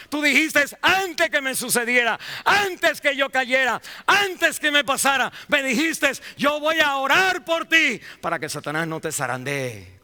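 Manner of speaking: shouted, very high and loud sermon climax; roaring pulpit climax; preaching at full force. Acted. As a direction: pour everything into the altar call.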